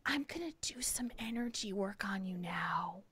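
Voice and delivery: unvoiced whisper, mimicking the masseuse; whispered imitation. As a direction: breathe it like a secretive healer. Masseuse quoted in hushed voice.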